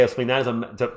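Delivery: normal talking voice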